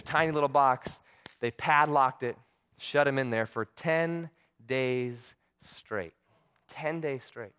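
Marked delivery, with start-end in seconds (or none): 3.6-6.1 TEN, DAYS, STRAIGHT spaced far apart, very slowly; slow, weighty "ten ... days ... straight"; drawn-out emphasis. Real, not acted. Repeats the length for weight. 6.7-7.5 "Ten days straight" said much softer, in one phrase; hushed repeat. Real, not acted. Repeats the length for weight.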